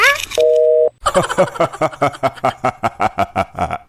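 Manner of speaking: long, low villainous laugh; Ravan-like laugh. Acted. Trapped husband gloats at his wife, laughing like Ravan. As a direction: roll out a demon king's booming cackle. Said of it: "ये रावण की तरह क्यों हंस रहे हो".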